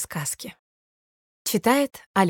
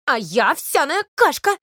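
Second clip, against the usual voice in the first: louder, heated retort; heated porridge; 5 semitones higher, 6 dB louder than usual. A narrator's reading. Porridge arguing with the milk, heated and loud. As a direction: snap back, getting hot. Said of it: "начинают постепенно горячиться".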